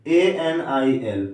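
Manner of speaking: ए एन आई एल letter by letter, slow; teacher spelling the word; spelling out slowly. Shown as a demonstration. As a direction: spell each letter deliberately. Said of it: "Anil".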